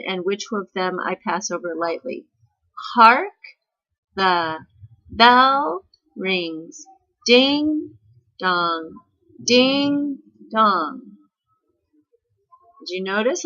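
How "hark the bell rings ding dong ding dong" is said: slow, separated words, "Hark", "bell" and each "ding" hit hard, "the", "rings", "dong" light; exaggerated alternate stress. Shown as a demonstration. Teacher reads for class to notice stresses, strong stress on "Hark", "bell", "ding". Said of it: "I'm gonna read them, and I want you to notice which ones I lay stress upon".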